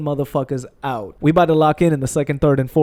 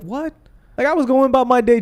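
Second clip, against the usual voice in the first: baffled; 9 semitones higher than usual. Acted. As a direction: pitch it up in disbelief. Acted reaction to unwanted remarks.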